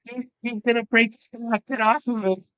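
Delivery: imitating frantic yells at the match; imitated yelling. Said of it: "just yelling".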